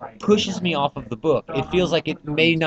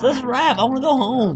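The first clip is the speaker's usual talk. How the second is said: rock star voice; 7 semitones higher than usual. Acted.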